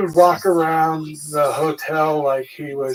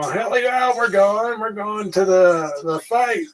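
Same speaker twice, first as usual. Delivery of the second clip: raised pitch, pumped-up mock cheer; pumped-up partiers, voiced higher; imitated hyped crowd; 6 semitones higher than usual. Partiers heading to the fight, imitated hyped cheer.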